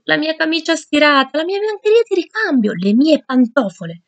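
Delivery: strilli acuti e disperati; strillo disperato. Acted. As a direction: lamento stridulo per i vestiti rovinati. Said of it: "Io strillai disperato". (Geronimo vede il bagaglio inzuppato, strilla disperato)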